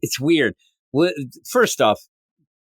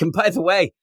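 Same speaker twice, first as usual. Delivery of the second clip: high, mocking gloat, much higher pitched; gloating Lex; 10 semitones higher than usual. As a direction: rub the loss in, sing-song and high. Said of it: "he is laughing it up".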